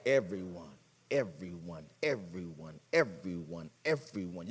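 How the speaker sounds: quiet. Acted. Repeated answer to congregation.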